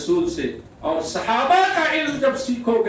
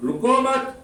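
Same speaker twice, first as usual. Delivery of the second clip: raised رکو مت as one unit; مت joined to رکو; 4 semitones higher than usual. Shown as a demonstration. Preacher showing the second meaning, مت joined to رکو. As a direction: say رکو مت together, then stop. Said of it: "کہنے والا کہہ رہا".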